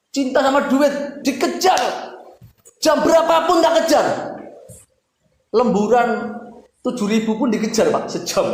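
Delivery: high, loud preaching shout; fiery preaching. Acted. Preacher rails at the congregation about chasing money, loud. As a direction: hammer it home to the congregation.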